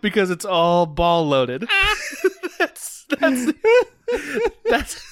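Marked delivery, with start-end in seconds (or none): 1.7-5.0 laughing. Real, not acted. Laughing through words.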